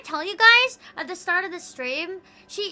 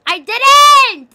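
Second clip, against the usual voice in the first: high, very loud drawn-out "I didn't"; slow full-volume shriek of "I didn't"; shouted denial; 9 semitones higher, 19 dB louder than usual. Real, not acted. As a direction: yell "I didn't" with everything. Shouting down chat.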